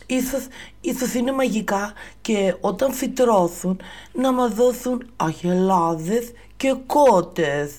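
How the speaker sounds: put-on high, loud lisping boy voice; hopeful child dreaming aloud; hopeful Jack. Jack hoping to his mother the beans grow cows, high lisping voice.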